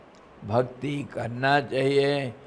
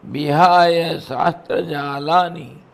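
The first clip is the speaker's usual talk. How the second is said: scripture recitation; 6 dB louder than usual. Acted. Preacher reciting scripture to listeners, intoned. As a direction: chant the verse line.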